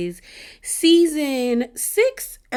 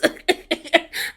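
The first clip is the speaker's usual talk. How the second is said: laugh; 9 semitones higher than usual. Real, not acted. Laughs at own tears.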